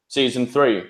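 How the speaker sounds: normal talking voice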